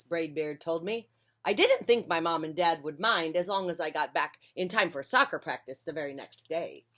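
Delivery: usual speaking voice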